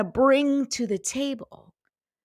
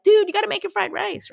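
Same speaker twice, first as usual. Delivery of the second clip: pleading high urging; 10 semitones higher, 4 dB louder than usual. Retold or imitated. Begging a good friend.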